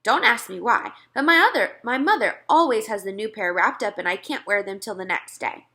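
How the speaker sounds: ordinary delivery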